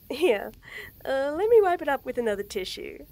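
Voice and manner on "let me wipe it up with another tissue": usual speaking voice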